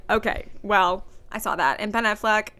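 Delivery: ordinary delivery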